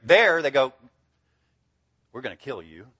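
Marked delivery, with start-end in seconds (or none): 2.1-2.9 low, very soft acted menace; hushed threat. Family threatening the new believer, hushed threat.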